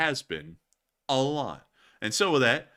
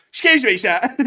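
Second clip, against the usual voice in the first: loud, high British character voice; hammed-up British heckler; Marmot, British accent; 8 semitones higher, 11 dB louder than usual. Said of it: "the Marmot got a British accent".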